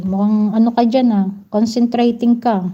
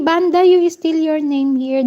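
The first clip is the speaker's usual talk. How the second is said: normal talking voice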